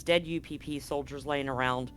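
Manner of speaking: ordinary talk, nothing marked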